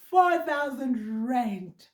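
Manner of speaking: Four thousand rand drawn out very slowly, each word hit; emphatic amount. Real, not acted. Telling viewers how much she'd lose, slow emphatic stress.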